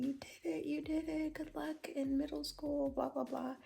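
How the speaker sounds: lightly sung-out congratulations, retold; imitated cheering. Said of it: "clapping at them and saying".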